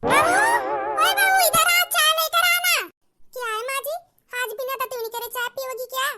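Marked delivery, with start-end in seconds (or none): 0.0-2.8 louder, higher bossy yelling; rude shouting. Acted. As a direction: bark her awake, then demand tea. Rude shouting to wake and order.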